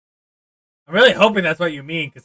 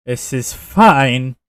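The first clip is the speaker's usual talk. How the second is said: loud; 8 dB louder, much slower than usual. Real, not acted. Loud slow repeat of this is fine.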